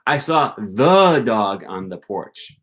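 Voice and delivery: the stressed, very slow; stress on the before dog. Shown as a demonstration. Teacher shows stress on the before dog. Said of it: "It was the dog".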